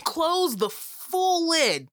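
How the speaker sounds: slow, high and emphatic; drawn-out emphasis. Pretend. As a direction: stretch every word out slowly. Mock stern instruction.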